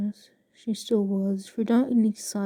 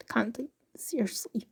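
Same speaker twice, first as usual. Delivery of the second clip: whispering; 7 dB quieter than usual. Real, not acted. Frustrated aside to self.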